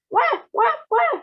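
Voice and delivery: put-on high, loud wailing baby sounds; baby crying. A narrator's reading. A grandma turned baby bawling on the bed. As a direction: wail like a newborn. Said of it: "bawling her heads off".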